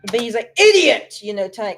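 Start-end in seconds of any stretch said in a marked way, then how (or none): 0.6-1.2 loud, high snapped insult; angry insult. Retold or imitated. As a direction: yell the insult hard. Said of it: "telling Demo Man that he's an idiot".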